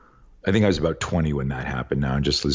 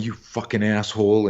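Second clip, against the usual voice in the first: ordinary delivery, nothing marked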